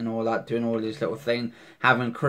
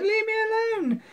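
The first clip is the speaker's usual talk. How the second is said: mock terrified scream. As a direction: imitate a frightened scream in a thin falsetto. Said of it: "is a bit terrified, he's screaming".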